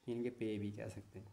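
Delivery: ordinary talk, nothing marked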